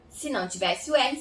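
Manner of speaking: usual speaking voice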